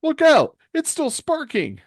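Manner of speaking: very high, loud cry of warning; shrieked warning from the crowd; alarmed bystander. Acted. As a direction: panicky high yell. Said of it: "One of the audience members goes".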